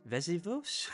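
"Vesuvus" said uncertainly with a questioning rise, syllable dropped; mispronounced. Real, not acted. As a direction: say "Vesuvus" as an unsure guess, rising up. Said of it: "I definitely mispronounced that".

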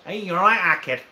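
Manchester accent. Shown as a demonstration. Mate answering the request, mock Manchester accent. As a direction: greet in put-on Manchester accent. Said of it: "Do the Manchester".

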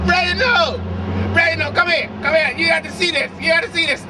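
yelling for Reginald; calling out. Acted. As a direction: call across the house. Calling friend over.

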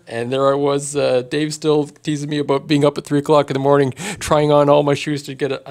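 usual speaking voice

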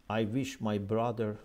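ordinary delivery

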